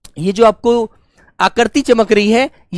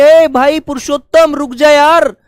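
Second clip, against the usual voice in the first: louder and higher warning shout from afar; calling out; 5 semitones higher, 4 dB louder than usual. Retold or imitated. Teacher acting a warning shout to a friend on a bike. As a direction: yell to stop a friend speeding. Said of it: "आप उसको दूर से आवाज मारेंगे".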